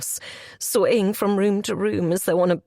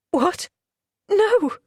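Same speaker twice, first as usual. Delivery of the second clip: shocked denial; 10 semitones higher, 3 dB louder than usual. Acted.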